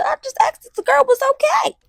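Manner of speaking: high-pitched imitation of a puzzled mother; high, incredulous mock question; baffled mom. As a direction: voice a taken-aback mom, way up high. Mom's baffled reply, acted.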